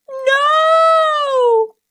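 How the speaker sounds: high loud cry of alarm; panicked. A narrator's reading. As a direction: scream it as he falls. Falling to the ground.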